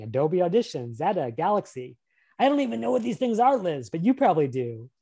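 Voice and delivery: ordinary talk, nothing marked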